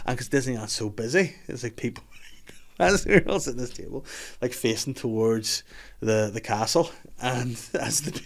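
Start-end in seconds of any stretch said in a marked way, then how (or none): none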